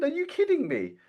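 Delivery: high-pitched imitation of an incredulous mentor; incredulous mock disbelief. Retelling her mentor scoffing in disbelief, high mock voice.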